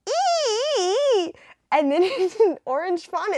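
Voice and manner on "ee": high squeal. Acted. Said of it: "in the car it's like".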